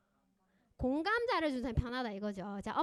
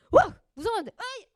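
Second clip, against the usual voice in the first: loud, high startled yell turning angry; scared then furious cry; scared-angry outburst; 11 semitones higher, 17 dB louder than usual. Shown as a demonstration. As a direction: shout like fear flipping into anger. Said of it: "이렇게 화가 나네".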